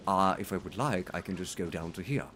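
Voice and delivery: low, soft traditional RP; traditional British accent. Shown as a demonstration. Trained speaker showing the accent they learned, traditional British accent. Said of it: "I can speak in a traditional British accent".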